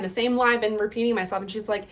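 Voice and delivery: ordinary talk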